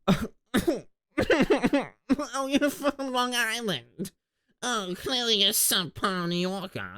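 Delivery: high, slow, mocking snob voice; mock posh New Yorker; snooty New Yorker. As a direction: sneer at Long Island from on high. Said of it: "Even New Yorkers will say".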